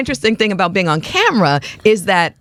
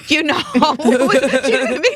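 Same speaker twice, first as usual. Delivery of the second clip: laughing; 7 semitones higher than usual. Real, not acted. Laughs about bad teachers.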